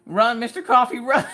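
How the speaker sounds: high, loud warning call; mock-alarmed shout; mock urgent cry. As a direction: urge him to flee, playfully loud. Mock urging Mister Coffee to flee.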